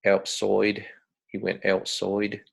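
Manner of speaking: Aussie outside, like 'outsoide'; demonstrated Australian accent; broad Australian accent. Shown as a demonstration. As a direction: imitate Australian vowels, 'oy' in outside. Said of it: "like becomes loik".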